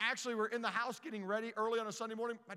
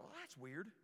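put-on puzzled kids; 2 semitones lower, 13 dB quieter than usual. Boys puzzled at window.

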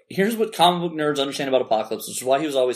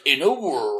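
movie trailer voice; slower than usual. Retold or imitated. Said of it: "I was like".

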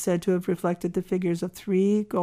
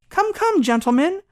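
high, loud remonstrance; exclaimed protest; 7 semitones higher, 5 dB louder than usual. A narrator's reading. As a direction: call the rioters to order in a raised voice. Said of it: "exclaimed the doctor".

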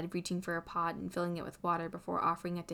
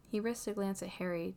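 usual speaking voice

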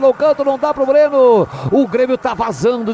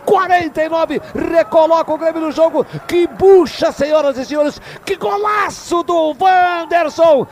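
higher, rapid raving commentary; excited praise; 4 semitones higher than usual. Real, not acted. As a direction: gush over the goal, still high. Narrator raves over the goal.

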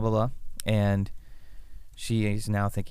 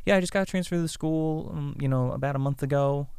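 lightly voiced student, higher pitch; new student; 5 semitones higher than usual. New student Sarah answers the class, lightly acted higher voice. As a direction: introduce yourself as the new kid, pitched up. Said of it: "And she's like".

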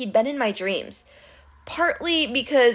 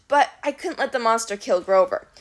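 plain reading voice

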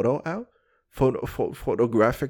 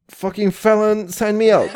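loud laughing sign-off; 7 semitones higher, 8 dB louder than usual. Acted.

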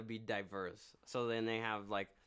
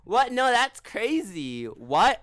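much higher and louder, dragged-out fake shock; reality show girl voice; 9 semitones higher, 12 dB louder, much slower than usual. Imitating the girls on the show denying they kissed, in a high loud mock-shocked voice. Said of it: "I'm talking like that because they talk like that on the fucking show".

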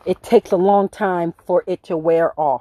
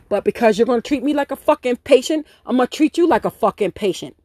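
ordinary talk, nothing marked

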